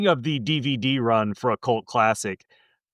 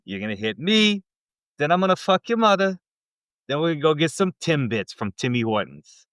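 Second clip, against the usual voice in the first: mock Canadian hockey captain, punchy lines; hammed-up Canadian brawler calling out a rival; Canadian accent; 3 semitones higher than usual. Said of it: "can I rehear yours".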